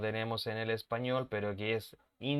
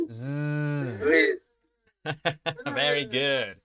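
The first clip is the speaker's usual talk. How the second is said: ordinary delivery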